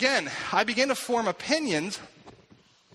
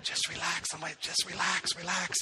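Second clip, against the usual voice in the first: softer, hushed coaxing; hushed urging; 6 dB quieter than usual. Retold or imitated. Pastor murmurs in the teenager's ear in the stream, hushed and urgent. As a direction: murmur it close so the crowd can't hear. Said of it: "So I whisper in her ear".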